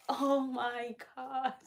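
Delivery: hushed, drawn-out gush; soft swoon. Real, not acted. Gushing to viewers about loving the Spanish accent, softly swooning. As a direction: swoon softly, stretching the words.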